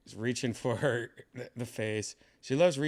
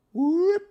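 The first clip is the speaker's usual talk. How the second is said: mock cat yowl; 13 dB louder than usual. Meowing at cat.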